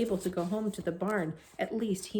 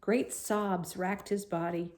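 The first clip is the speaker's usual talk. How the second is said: ordinary delivery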